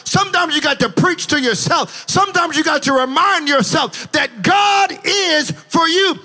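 fervent climax; preaching at full force. Acted. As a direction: pound the refrain home.

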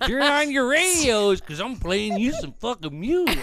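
Will Ferrell-style impersonation. Acted. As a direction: bellow it like an over-the-top baseball announcer. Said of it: "You sound like when Will Ferrell does that fucking impersonation".

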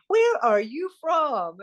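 high, slow, stretched Southern question; Southern accent. Retold or imitated. Student meeting a fellow Southerner, high slow Southern drawl. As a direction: draw it out high with a Southern twang. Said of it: "I heard this Southern voice".

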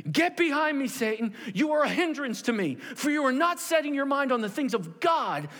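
high, forceful, impassioned scolding; stern rebuke. Acted. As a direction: drive each phrase home as Jesus. Acting Jesus rebuking Peter.

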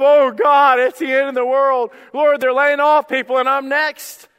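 high, loud, panicked wail of doom; panicked whining. Retold or imitated. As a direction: cry out as if the world is ending. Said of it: "we go into whine mode automatically".

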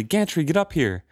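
high panicked call, stress on up; calling out wildly. Acted. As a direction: yell for the first mate. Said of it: "he called out wildly".

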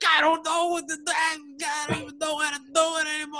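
a hammed-up impression of a frustrated comic yelling at the mic; Bill Burr impression. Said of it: "I don't do a good Bill Burr".

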